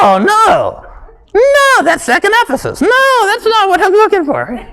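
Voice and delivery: high, put-on fretting over the verse; fake searching. Boy faking a search for a Bible verse, high and flustered. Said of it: "pretend I was looking for the verse".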